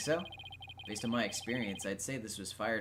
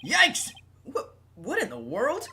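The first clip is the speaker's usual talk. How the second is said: startled; 13 semitones higher, 10 dB louder than usual. Acted. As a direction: yelp of surprise, much higher. Startled by a talking robot.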